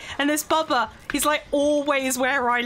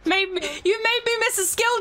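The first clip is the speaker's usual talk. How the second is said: mock outrage; 5 semitones higher than usual. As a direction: ham up the offence. Mock outrage at stolen hat.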